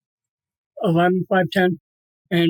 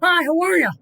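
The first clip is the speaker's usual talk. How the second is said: high, bright mock greeting from the dog; a high chirpy dog voice saying hello; dog's cheerful greeting; 9 semitones higher than usual. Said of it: "and he goes".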